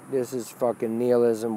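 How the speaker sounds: usual speaking voice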